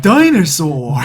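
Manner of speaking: slow, loud, stilted recording voice; mock textbook voice. Friend mocking the CD, slow stilted voice. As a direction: ham up the unnatural CD reader.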